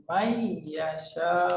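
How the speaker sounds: মাইয়াশাউ very slow, nun merged into doubled ya; slow idgham demo. Shown as a demonstration. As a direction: stretch মাইয়াশাউ syllable by syllable. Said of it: "এখন কিভাবে পড়বো?". (ধীরে মাইয়াশাউ, নুন ইয়াতে মিশে)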